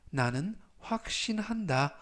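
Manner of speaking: usual speaking voice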